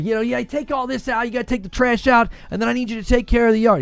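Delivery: quick, high rundown of orders; dad giving chores. Retold or imitated. Dad listing yard chores for his son, high and brisk.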